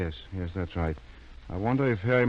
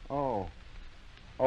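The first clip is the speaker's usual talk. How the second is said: ordinary talk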